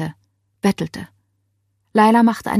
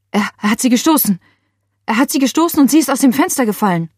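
fast, higher, rushed report; panicked; 5 semitones higher, 3 dB louder, faster than usual. A narrator's reading. Laila reporting the fall to the emergency operator, panicked. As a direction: blurt it out in panic.

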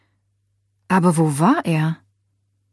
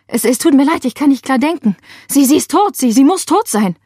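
higher, louder stammering panic; falling apart on the phone; distraught; 6 semitones higher, 5 dB louder than usual. A narrator's reading. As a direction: Laila breaks down to the operator: stammering panic. Distraught caller breaking down.

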